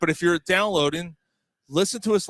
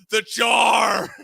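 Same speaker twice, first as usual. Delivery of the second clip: loud, higher-pitched exclamation; shouted punchline; quoted shout; 5 semitones higher, 6 dB louder than usual. Retold or imitated. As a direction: belt out the quote. Quoting Peterson's exclamation.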